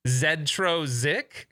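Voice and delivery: "Zedro Zik" drawn out, starting with "zed"; amused, slow "Zed-ro Zik"; zed inside a word. Shown as a demonstration. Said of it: "How do you do it when it's in a word".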